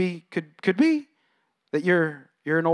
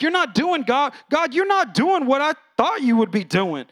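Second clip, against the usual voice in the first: put-on older brother complaining; 6 semitones higher, 3 dB louder than usual. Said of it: "And he's like".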